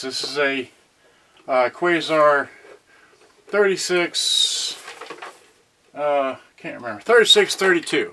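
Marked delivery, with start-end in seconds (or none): none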